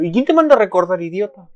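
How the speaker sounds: high, loud playful scolding; exasperated mock rebuke. Real, not acted. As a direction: tell him off at the screen. Scolding game character.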